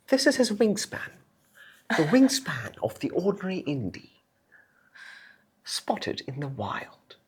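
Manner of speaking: hushed, high nature-documentary narrator; David impression. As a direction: narrate a wildlife sighting in hushed wonder.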